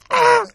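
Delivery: sharp pained yelp; high yelp of cramp pain; pained cry. Retold or imitated. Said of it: "you could hear you go".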